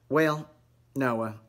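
ordinary talk, nothing marked

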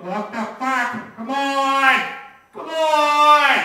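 full furious yell at a red light; road rage fit. Retold or imitated. Buddy screaming at the red light, furious. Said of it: "he's having a connection fit".

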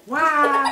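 high loud yelp while falling; falling scream. Pretend. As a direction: wail as you drop. Villain thrown into jail.

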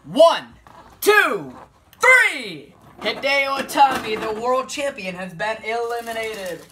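loud, high shouted pin count; excited count. Real, not acted. Gamer counting the pin and calling the elimination, excited shout. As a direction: bellow the three count then announce it.

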